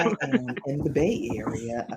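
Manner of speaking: low, sultry mimicry of a guest; imitated exotic voice. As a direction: imitate a smooth, exotic-sounding speaker. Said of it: "like, an exotic voice too".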